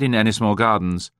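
plain reading voice

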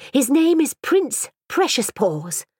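slow, high, proud voice; proud little girl. A narrator's reading. Girl proudly naming her dog, slow and high. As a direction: announce the grand name proudly.

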